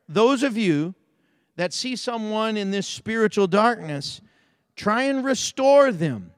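a pastor building up his sermon; preaching. Acted. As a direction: preach it out to the congregation. Exhorting the congregation.

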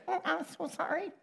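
high, soft, sheepish sorry; meek apology. Retold or imitated. Moses backing off from God, high and sheepish. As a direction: shrink back and apologise, high and timid. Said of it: "Moses just went".

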